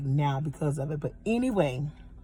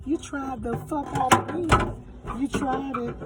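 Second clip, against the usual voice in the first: high, drawn-out outraged scolding; indignant; 10 semitones higher, slower than usual. Real, not acted. Telling off the store over cracked drawers, indignant. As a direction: scold them slowly, pitch way up.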